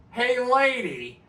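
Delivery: high, drawn-out shouted "Hey, lady!"; rude loud call. Shown as a demonstration. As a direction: yell "lady" slow and high across a lot. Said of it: "it depends how you say it".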